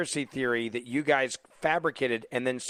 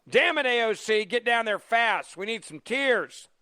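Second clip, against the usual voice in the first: mock urgent call; 7 semitones higher than usual. Real, not acted. Host mockingly summons AOC.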